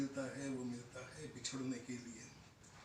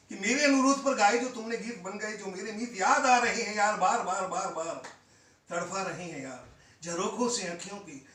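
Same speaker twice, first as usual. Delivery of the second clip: loud, ringing verse that falls away at the close; impassioned poetry recitation; 3 semitones higher, 9 dB louder than usual. Acted. Poet calling Poonam to return, impassioned recitation. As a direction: declaim it as a plea to come back.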